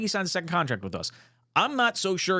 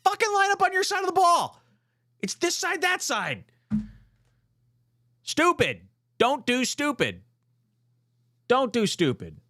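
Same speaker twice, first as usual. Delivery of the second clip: fired-up yelling; 11 semitones higher than usual. Real, not acted. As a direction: yell it like the team just blew it again. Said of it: "Can you tell I'm fired up?".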